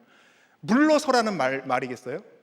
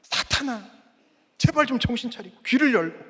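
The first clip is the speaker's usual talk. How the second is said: high, raised acted appeal; insistent rebuke, pitched up; exasperated plea; 4 semitones higher than usual. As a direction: urge someone who won't listen. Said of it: "너무 안 들으니까".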